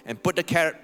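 ordinary delivery

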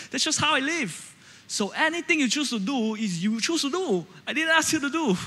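high, heated son; argument explodes. Retold or imitated. Son arguing with his mom as the fight explodes, heated. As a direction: push back high and indignant. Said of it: "the argument just explodes".

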